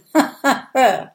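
loud, boastful chuckle; hearty laugh. A narrator's reading. Viking boasts of being feared, hearty laugh. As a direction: laugh proudly at your own tale.

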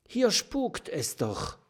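high, nervous little porcupine; small frightened porcupine voice; fearful porcupine. A narrator's reading. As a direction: squeak it, sure it's haunted.

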